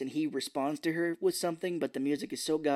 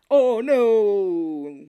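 loud, higher, drawn-out wail; mock horror; 6 semitones higher, 11 dB louder than usual. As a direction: cry out in hammed-up dismay. Mock horror at staged fall.

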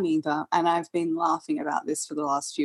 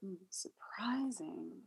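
slow, whispered thinking aloud; whispered musing; 2 semitones higher, 15 dB quieter, much slower than usual. Real, not acted. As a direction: murmur the word slowly while you think. Musing over the question.

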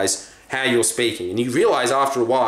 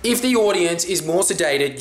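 usual speaking voice

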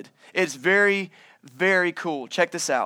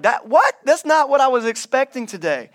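surprised blind man; 6 semitones higher, 5 dB louder than usual. Acted. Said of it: "be the blind guy and be like".

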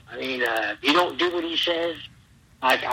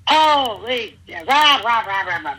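higher, louder hammed-up tirade of gibberish; mock screaming; 7 semitones higher, 3 dB louder than usual. Trainer storming off after hearing the age, mock hollering. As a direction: rant like a hot-headed trainer. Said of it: "he's screaming, hollering".

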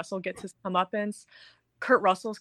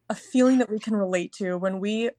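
ordinary talk, nothing marked

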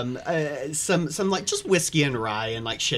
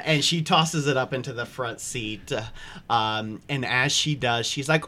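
normal talking voice

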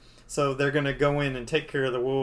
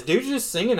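ordinary talk, nothing marked

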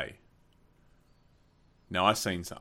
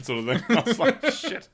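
laughing through the words, much higher; 7 dB louder than usual. Real, not acted. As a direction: crack up while telling it. Laughing while retelling the vomiting.

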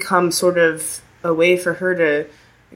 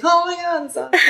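mock sympathy; 12 semitones higher, faster than usual. Acted reaction to hearing "devo.

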